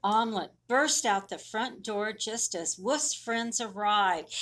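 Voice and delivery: plain reading voice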